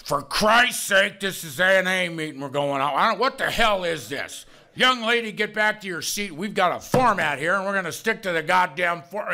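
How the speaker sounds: mock indignant deacon. Bleeding deacon scolds young lady for breaking format, mock indignation. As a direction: scold like an imagined stickler for the rules. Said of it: "there were no bleeding deacons in there going".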